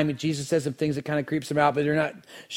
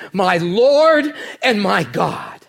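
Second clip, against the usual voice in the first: slow, louder and higher, each word weighed; awed declaration; 6 semitones higher, 8 dB louder, much slower than usual. Acted. Thomas confessing before the risen Jesus, slow awed declaration. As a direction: proclaim it as a worshipper overwhelmed.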